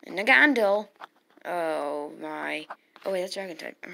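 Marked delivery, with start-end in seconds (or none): 0.1-0.9 loud alarmed name. Real, not acted. Reacting to opponent's Pokemon.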